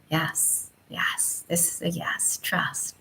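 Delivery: soft, near-whispered affirmation; barely voiced, trusting murmur; hushed inner yes. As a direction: let the body quietly whisper its assent. Body's inner voice says yes.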